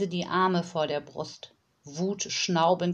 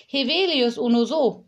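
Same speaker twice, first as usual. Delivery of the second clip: put-on higher, louder childlike chirp; little girl voice; 6 semitones higher, 6 dB louder than usual. Little girl answers the nanny in her own language, bright. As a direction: play the small girl's made-up language. Small girl's nonsense words.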